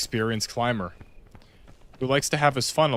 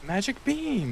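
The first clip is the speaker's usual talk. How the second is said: high squeaky voice; 12 semitones higher, 3 dB quieter, slower than usual. Pretend. High squeaky exclamation in game.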